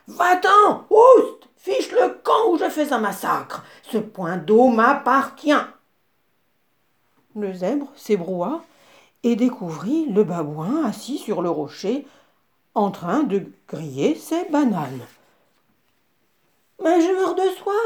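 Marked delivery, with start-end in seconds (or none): 0.1-5.5 cri perçant, très aigu et fort; voix furieuse. A narrator's reading. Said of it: "Une voix furieuse lui cria". 16.8-17.7 voix plus aiguë de zèbre assoiffé. A narrator's reading. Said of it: "dit le zèbre".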